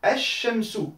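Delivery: "Esh Shamsu" with a long held shin; doubled shin. Shown as a demonstration. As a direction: press on the shin, no L sound. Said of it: "j'appuie sur le shin".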